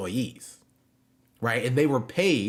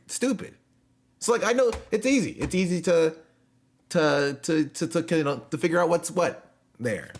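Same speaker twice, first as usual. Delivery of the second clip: high, loud sarcastic explainer; mocking; 7 semitones higher, 3 dB louder than usual. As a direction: explain it like a smug know-it-all. Mocking American politics lecture.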